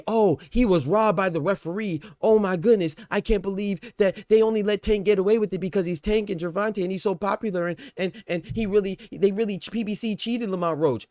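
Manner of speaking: high-pitched imitation of complaining fans; put-on scandalized protest; mock outrage.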